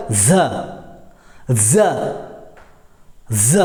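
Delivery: ज said as a buzzing "za"; isolated, demonstrated "za" for ज; nukta za sound. Shown as a demonstration. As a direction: say ज with a voiced z, each one set apart. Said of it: "उच्चारण क्या हो गया".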